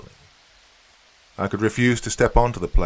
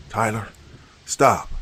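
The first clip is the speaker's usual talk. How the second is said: plain reading voice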